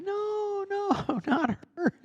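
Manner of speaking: very high squealed no, laughing; kids protesting. Retold or imitated. Kids laughing at the clown's mistake, high squeal. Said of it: "And they say".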